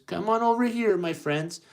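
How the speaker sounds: high, loud coaxing call; high-voiced fairy godmother summoning helpers; beckoning godmother. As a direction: call the little mice over sweetly. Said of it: "looks at the mice friends and says".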